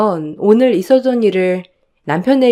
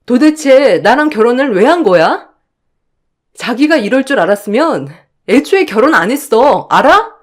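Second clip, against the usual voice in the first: angry outburst; 4 semitones higher, 4 dB louder than usual. Acted. Wife quarrels with husband.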